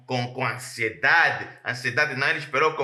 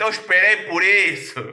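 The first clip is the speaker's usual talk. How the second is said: louder, firm insistence; emphatic declaration; 4 semitones higher, 6 dB louder than usual. Acted. Preacher voicing Caleb insisting he waited, loud and firm. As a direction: plant it like a vow.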